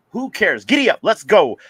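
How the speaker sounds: loud, high host rally; rousing hype. Real, not acted. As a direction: fire up the audience loudly. Host rallying the show to start.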